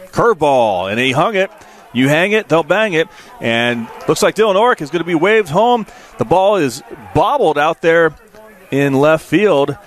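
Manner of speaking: rising play-by-play thrill; quick lift into the home-run-style call; excited call. Real, not acted. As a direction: voice jumps high as the runner is waved home. Announcer calls a hit, runner scoring.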